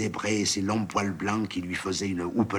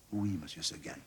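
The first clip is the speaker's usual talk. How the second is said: quiet, meek reply; soft little goat; 3 semitones lower, 9 dB quieter than usual. A narrator's reading. Blanquette answering her master, soft and meek. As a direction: answer gently, head down.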